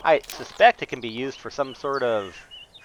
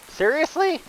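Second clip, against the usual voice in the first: incredulous; 14 semitones higher than usual. Real, not acted. Boss blocks arrows.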